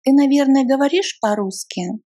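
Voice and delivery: ordinary talk, nothing marked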